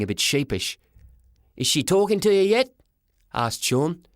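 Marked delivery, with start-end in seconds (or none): 1.6-2.7 put-on high-pitched kid asking; high, curious young lad; boy's voice. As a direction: ask it up high with a rising tune. Boy asks his father.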